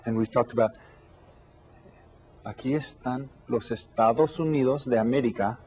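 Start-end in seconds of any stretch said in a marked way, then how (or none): none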